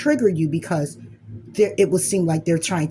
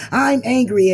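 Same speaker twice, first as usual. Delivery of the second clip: voicing Anger, higher and louder; 5 semitones higher, 6 dB louder than usual. Anger talking back to you, raised and loud. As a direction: let Anger blurt it out. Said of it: "Angry's like".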